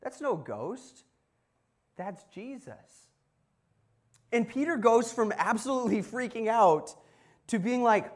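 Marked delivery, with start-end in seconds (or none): 0.0-3.1 slow, hushed realization; dawning realization. Acted. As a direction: let it sink in slowly, softly. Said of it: "they realize".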